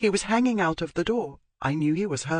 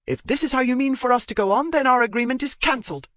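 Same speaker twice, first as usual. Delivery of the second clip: higher and louder, indignant tirade; furious retort; 10 semitones higher, 5 dB louder than usual. Acted. Zoe berating Marchmont for making a scene, raised and furious. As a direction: lash back at him, voice raised.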